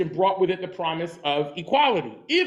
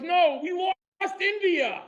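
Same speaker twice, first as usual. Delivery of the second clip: high, slow old-man voice, lightly put on; a lightly voiced wistful old Englishman; mock British grandfather; 11 semitones higher, slower than usual. Grandfather's punchline in a joke.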